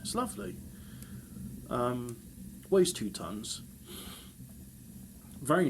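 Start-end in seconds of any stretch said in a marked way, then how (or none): 0.0-0.5 mock delight. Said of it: "I thought".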